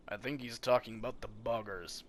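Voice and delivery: ordinary talk, nothing marked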